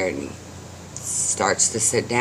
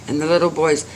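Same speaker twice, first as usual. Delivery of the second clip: ordinary delivery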